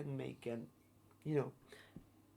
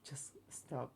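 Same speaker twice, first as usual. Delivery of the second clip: whispered; 10 dB quieter than usual. Retold or imitated. Retold telling boss to stop.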